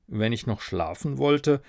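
usual speaking voice

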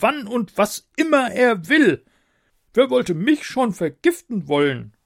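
high, loud, impatient king voice; roaring tyrant king. A narrator's reading. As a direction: bark orders like a spoiled monarch.

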